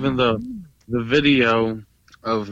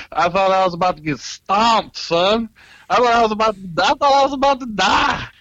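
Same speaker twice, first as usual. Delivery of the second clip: loud, high-pitched retold panic; animated alarm; 10 semitones higher, 5 dB louder than usual. Tattooist retelling a scare with an angry husband, alarmed. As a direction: raise the voice high and loud, hammering out the fear. Recounts fearing a beating, animated.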